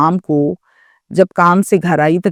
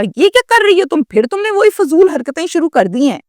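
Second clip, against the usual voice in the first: higher, sharp mother's scold; angry scolding; 8 semitones higher than usual. Retold or imitated. Mother catching her daughter again, angry higher scold. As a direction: snap at the daughter in anger. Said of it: "میں نے غصہ کیا".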